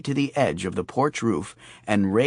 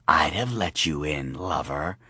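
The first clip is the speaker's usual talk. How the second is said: spooky seductive voice; 8 semitones lower, 4 dB quieter than usual. Acted. Spooky female voice from sewer.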